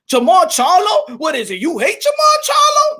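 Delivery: hammed-up squeaky indignation; mock outraged viewer. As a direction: whine in disbelief, voice shooting up. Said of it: "gonna be people that's gonna look at the thumbnail and be like".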